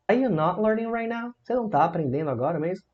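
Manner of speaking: "Are you not learning right now" high questioning tune; negative question intonation. Shown as a demonstration. Teacher questioning the student, "Are you not learning right now" in high question tune. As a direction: ask it upward, "Você não tá aprendendo agora mesmo". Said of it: "Frases interrogativas na negativa".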